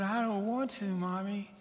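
put-on high, reluctant little-girl voice; scared little girl. Scared girl to her pushy mom, pleading. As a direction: plead softly like a frightened child. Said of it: "scared to death".

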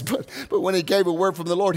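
normal talking voice

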